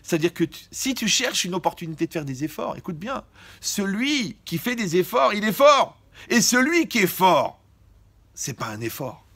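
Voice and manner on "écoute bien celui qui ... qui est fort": slow, loud, each word hammered; slow emphatic preaching. Real, not acted. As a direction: pound the words out. Preacher hammering home a point.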